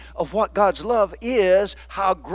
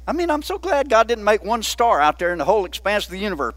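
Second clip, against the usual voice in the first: ordinary talk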